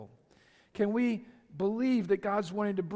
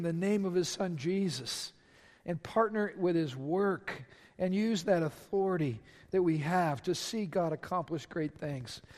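normal talking voice

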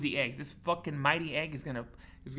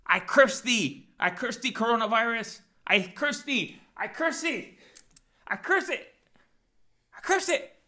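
rising, louder and higher curse chant; laying a curse; 6 semitones higher, 7 dB louder, slower than usual. Acted. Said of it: "I have a prophecy that I'm gonna read to the coronavirus".

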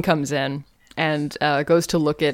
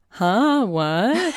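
badly faked innocent puzzlement; higher-pitched, drawn-out playing dumb; bad liar; 5 semitones higher than usual. Suspect dodging police questions about Stan, badly faking innocence. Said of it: "really bad at lying".

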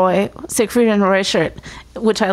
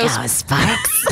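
strange far-off alien shout; 4 dB quieter than usual. Retold or imitated. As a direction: chant it like a hollow alien. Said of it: "That was pretty good".

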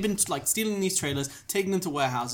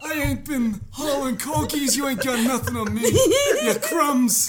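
higher, mock shouting New Jersey boss; defiant Jersey mobster denial; New Jersey accent yelled through laughter; Jersey mob boss; 8 semitones higher, 4 dB louder, slower than usual. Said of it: "does that voice".

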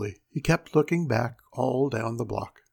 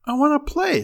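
put-on high, whiny boy begging to join; eager child's plea, pitched way up; pleading boy; 13 semitones higher, 4 dB louder than usual. A narrator's reading. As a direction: plead high, like a left-out kid. Boy begs to join the game.

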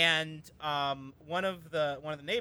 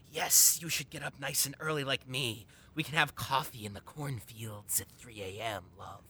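lightly voiced British snake; 6 semitones lower than usual. On request, the neighbor's voice, lightly imitated. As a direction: slide into a mild creepy snake voice. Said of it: "Do the voice".

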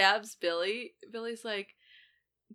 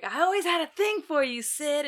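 dying Stu; 8 semitones higher than usual. Retold or imitated. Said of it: "he's like".

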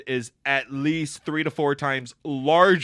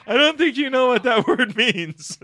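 slow, louder, laughing through it; laughing movie quote; 10 semitones higher, 8 dB louder than usual. Retold or imitated. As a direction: quote it while cracking up. Princess Bride quote.

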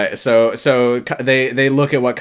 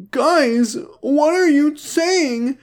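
very high, slow tearful plea; hammed-up wail, drawn out; mock pleading; 14 semitones higher, much slower than usual. Brother defending Kyle to the family, high mock-tearful pleading. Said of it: "and he's like".

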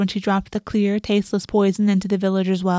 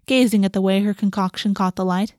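usual speaking voice

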